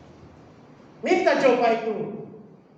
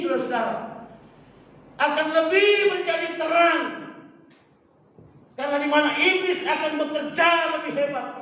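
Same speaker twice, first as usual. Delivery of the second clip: raised, emphatic preacher's voice; fervent preaching; 6 semitones higher than usual. Acted. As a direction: drive each phrase home like a preacher. Preaches with force. (khotbah berapi-api)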